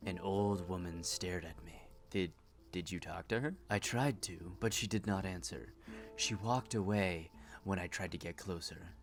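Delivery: soft, low, brooding patient; quiet troubled woman. Acted. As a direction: tell it like a haunted confession. Patient continues eerie story.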